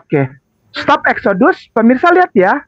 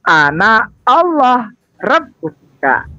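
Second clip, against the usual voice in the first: slow reading; 2 semitones lower, much slower than usual. Shown as a demonstration. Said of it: "Tuhannya mereka adalah".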